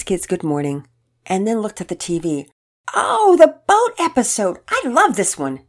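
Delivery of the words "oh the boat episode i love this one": loud, high burst of delight; excited. A narrator's reading. Daddy Peters seeing his favorite episode, excited. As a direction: burst out like a thrilled fan.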